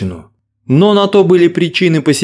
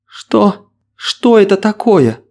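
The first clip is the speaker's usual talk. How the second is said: hoarse, shocked whisper; 6 semitones higher, slower than usual. Acted. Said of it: "севшим голосом выдавила девушка".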